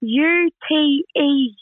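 slow, letter by letter spelling; spelled out slowly. Shown as a demonstration. Spelling ute for the American host, slowly letter by letter. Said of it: "how do you spell that".

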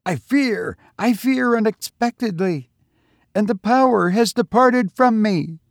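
high, strained, terrified voice of a pilgrim losing his nerve; a frightened pilgrim shrieking his fear. A narrator's reading. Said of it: "the pilgrim shrieked".

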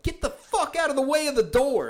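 high, loud yelled order from an angry lady; imitating a fed-up lady yelling; mock yell. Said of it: "some lady yelled".

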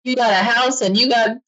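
mock scolding parents. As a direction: lecture the ungrateful kid in jest. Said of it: "They're like".

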